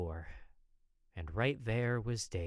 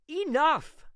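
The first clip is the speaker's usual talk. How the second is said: sudden furious shout, very high and loud; angry outburst at his brother; snapped; 10 dB louder than usual. Acted. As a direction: cut him off hard. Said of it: "I snapped".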